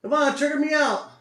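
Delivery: loud, high cheer at the screen; urging the trade on, loudly; excited shout. Real, not acted. Trader cheering his order to fill, loud and high.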